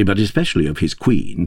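ordinary delivery

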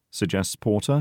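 ordinary delivery, nothing marked

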